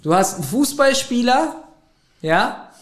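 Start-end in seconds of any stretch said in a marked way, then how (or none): none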